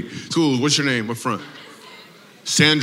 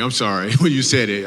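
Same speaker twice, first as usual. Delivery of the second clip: usual speaking voice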